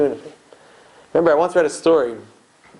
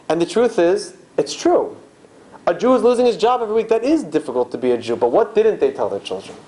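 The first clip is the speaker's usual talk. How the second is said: usual speaking voice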